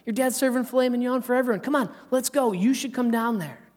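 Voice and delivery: high, eager, bubbly worker voice; a gushing farmhand spilling the news; excited worker. Retold or imitated. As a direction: worker tells the older son his brother is back, high and eager. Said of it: "And the worker says".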